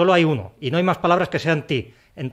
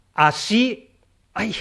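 mock pain; 5 semitones higher, much slower than usual. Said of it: "esta duele".

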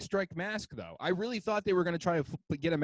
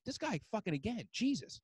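higher, softer mock exasperation; exasperated; 6 semitones higher, 9 dB quieter than usual. Imagined Adidas staff reacting to [name]'s tweet, mock exasperated. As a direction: grumble in disbelief, pitched up and under your voice.

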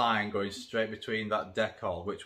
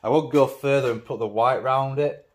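ordinary delivery

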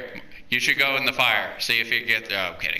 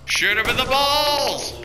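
excited yell; 11 semitones higher, 4 dB louder than usual. Real, not acted. Co-op zombie game, excited yelling.

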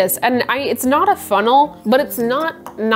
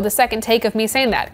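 ordinary delivery

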